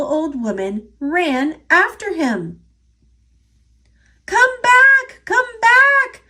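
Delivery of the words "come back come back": high, loud calling out; loud high yell of the old couple. Acted. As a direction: shout after him to come back. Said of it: "they yelled".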